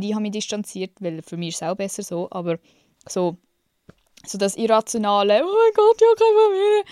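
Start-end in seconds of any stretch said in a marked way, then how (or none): 5.4-6.8 much higher, loud dramatic wail; mock dramatic self-pity. Mocking her irrational self-pity. (viel höher und laut, übertriebenes Selbstmitleid)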